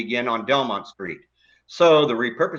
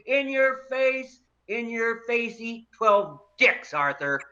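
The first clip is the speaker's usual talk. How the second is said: gloating taunt; 7 semitones higher than usual. Acted.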